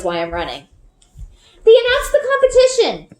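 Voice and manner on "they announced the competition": excited shout, much higher; girl shouting the news as she runs home, high and loud. A narrator's reading. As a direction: burst in yelling the big news. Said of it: "I shout".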